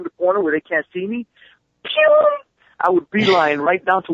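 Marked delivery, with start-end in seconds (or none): 1.8-2.3 high whoosh sound for speeding off; a quick cartoon zoom noise; zip sound effect. Mimicking his sudden dash away.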